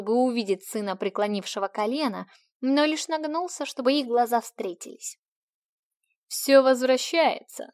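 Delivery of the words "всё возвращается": slow, stern warning. A narrator's reading. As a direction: say it sternly and slowly. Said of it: "сказал он строго".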